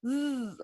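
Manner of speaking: buzzing zzz; vibrating Z sound, like a bee. Shown as a demonstration. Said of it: "suena como abejita ... es como zzz".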